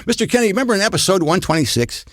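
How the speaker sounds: high, quick fan voice; eager fan. Retold or imitated. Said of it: "They'll say, like".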